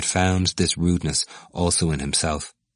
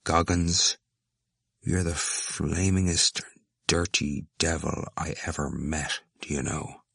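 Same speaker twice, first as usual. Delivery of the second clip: slow, drawn-out insult, dropping softer; vehement without anger; 6 dB quieter, slower than usual. A narrator's reading. Cranley turning on the stout student, vehement but without anger. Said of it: "said vehemently, but without anger".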